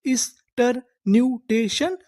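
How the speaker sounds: slow, rising, each syllable clear; a slow teaching rise on ईस्टरन्यूटेशन; slow drawn-out reading. Shown as a demonstration. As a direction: stretch the word out for students. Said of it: "क्या बोलते हैं?".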